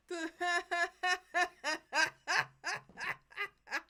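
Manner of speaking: loud, high, long cackle; hard laughing fit. Real, not acted. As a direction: crack up helplessly in loud, repeated bursts. Cracks up at game line.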